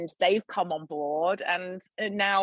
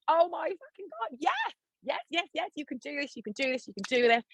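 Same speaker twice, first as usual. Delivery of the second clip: high, squealing mock cheer that softens to encouragement; imitating gushing fans cheering her on; giddy high-pitched yes yes yes; excited cheering; 9 semitones higher than usual. Retold excited fan messages.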